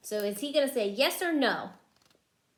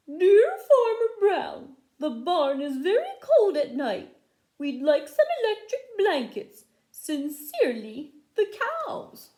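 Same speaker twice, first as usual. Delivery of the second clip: cows' letter voice; 8 semitones higher, 8 dB louder than usual. Acted. Teacher reads the cows' note to the children in a high, loud cow voice. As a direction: read it as a silly squeaky cow. The cows put their letter.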